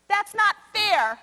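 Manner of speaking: indignant protest. Retold or imitated. Teacher voicing her frustration at the children's suffering, indignant and slow. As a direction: stretch it out as a hurt protest.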